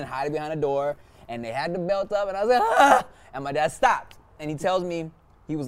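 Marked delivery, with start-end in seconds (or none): 2.6-3.0 high, loud yelp of fear; short acted scream, high and loud; mock frightened scream. Child hiding from dad's belt, mock frightened scream. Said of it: "I saw the fear in your eyes".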